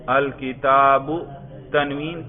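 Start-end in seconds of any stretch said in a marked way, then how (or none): 0.1-1.1 louder, drawn-out الکتاب with a final short u; nominative ending -u. Shown as a demonstration. Teacher demonstrates raf' to student, الکتاب slowly ending in -u. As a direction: say الکتاب slowly and firmly as "al-kitaabu". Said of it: "کر کے دکھائیے".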